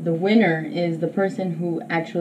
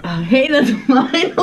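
laughing protest; slower than usual. Real, not acted. Partner takes her water.

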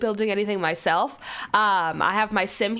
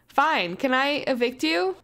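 normal talking voice

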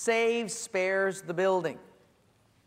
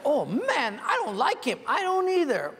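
high, louder comic banter; the couple's high, louder comic banter about Vern; mock agreement; 8 semitones higher, 4 dB louder than usual. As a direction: egg each other on. Husband and wife agreeing.

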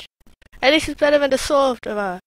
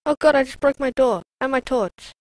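ordinary delivery

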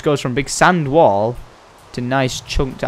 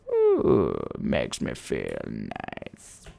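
very soft, slow purr of pleasure; savouring; 8 dB quieter, much slower than usual. Pretend. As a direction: draw it out slowly, very softly. Savouring a fresh feeling.

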